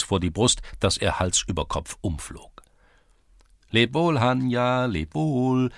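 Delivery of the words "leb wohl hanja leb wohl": slow, high drawn-out calls; lilting high call; called farewell. A narrator's reading. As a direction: sing out the goodbye, lengthened. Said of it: "riefen mehrere auf einmal".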